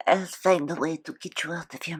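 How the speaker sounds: breathless whisper of a vow; whispered promise. Acted. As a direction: whisper so no one overhears. Secret promise to captive creature.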